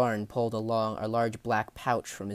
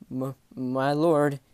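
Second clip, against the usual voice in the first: slow, louder, faltering plea; terrified stammer; 3 semitones higher, 6 dB louder, slower than usual. Acted. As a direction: stammer it out in fear. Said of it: "The sailor was terrified and torn".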